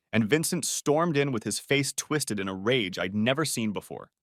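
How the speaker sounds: usual speaking voice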